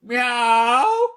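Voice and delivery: high mock meow back to the cat; the tailor mews back to his cat; playful high cat cry; answering meow. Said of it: "the tailor replied".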